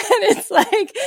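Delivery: high laugh running into the words. Real, not acted. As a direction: laugh through "And it's like". Laughing at own joke.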